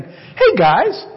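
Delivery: high, playful voicing of Jesus chiding friends; gentle rebuke. Jesus gently scolding the frightened disciples, high and laughing. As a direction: tease them out of their fear with a laugh. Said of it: "he rebukes them saying".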